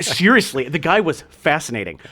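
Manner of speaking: normal talking voice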